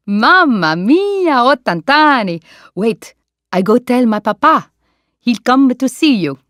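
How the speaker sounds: put-on a playful Italian accent, high and friendly; Italian waiter. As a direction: greet the old lady like a warm Italian waiter.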